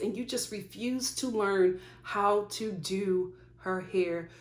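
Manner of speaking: ordinary delivery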